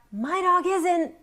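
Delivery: high, drawn-out mock yell with a laugh; yelling back. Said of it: "And I yell back".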